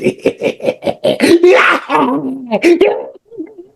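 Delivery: high, loud mock-villain cackle; hammed-up wicked laughter; evil cackle. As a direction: cackle like a gleeful cartoon villain. Host hams up criminal activities.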